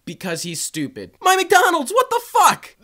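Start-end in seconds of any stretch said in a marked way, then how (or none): 1.2-1.9 high, loud shrieking outrage; outraged shriek. Retold or imitated. Girl finds her food eaten. 1.9-2.7 what the fuck shrieked high and loud; shrieked outrage. Retold or imitated. Girl finds her food eaten.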